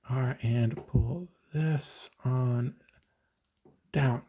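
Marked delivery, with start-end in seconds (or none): none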